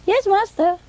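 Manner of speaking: high-pitched imitated boy assistant; boy's voice. Parrot mimics young assistant answering owner, boy's voice. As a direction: answer in a high boyish pipe. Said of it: "say it in a boy's voice".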